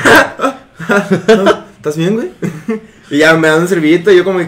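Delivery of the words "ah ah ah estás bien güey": a mock-concerned question broken by giggles; laughing while asking. Dairy Queen workers laughing at his bleeding head, asking if he's okay. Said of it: "de ser así como que".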